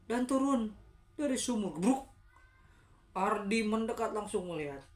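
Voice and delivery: urgent, loud ghost-story telling; animated, suspenseful storytelling; dramatic story narration. A narrator's reading. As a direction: jump in suddenly and build the scare.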